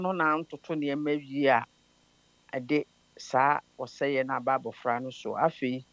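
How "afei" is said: usual speaking voice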